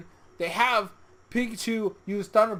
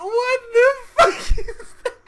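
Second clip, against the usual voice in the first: high, loud laughing shout; laughing disbelief; 8 dB louder than usual. Retold or imitated. Fan laughing in disbelief at Bewear's rooftop hop. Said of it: "And I was like".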